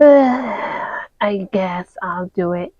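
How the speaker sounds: soft, slow, sighing; a quiet slow resigned sigh; reluctant sigh. Acted. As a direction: give in with a sigh. Mickey reluctantly agrees.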